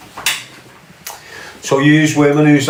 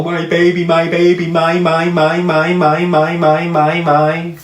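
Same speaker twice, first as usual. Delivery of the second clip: higher, rapidly repeated mock whining; mocking repeated plea; 5 semitones higher than usual. Mimicking a man going on about his baby, mocking plea. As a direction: parrot it in a high, insistent chant.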